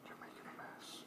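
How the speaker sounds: whispering. Real, not acted. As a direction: hush it right down. Whispering to the baby.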